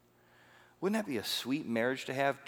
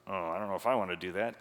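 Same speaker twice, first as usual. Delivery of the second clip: reluctant wife; 4 semitones lower, faster than usual. Retold or imitated.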